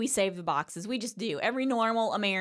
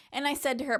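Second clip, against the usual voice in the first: normal talking voice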